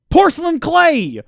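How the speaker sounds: high, loud, drawn-out triumphant exclaim; eureka exclaim. Real, not acted. Streamer figuring out the recipe, triumphant exclaim. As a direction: announce it like a discovery.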